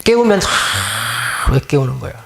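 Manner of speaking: very slow, drowsy complaint; groggy grumble. Pretend. Someone woken too early complains to whoever woke them, groggy. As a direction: drag the words out like you were just woken. Acting annoyed at being woken.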